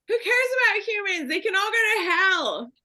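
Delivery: high-pitched, frantic angry cry; furious outburst. Acted. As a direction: lash out in a very high voice. Condemned X-Man lashes out at humans.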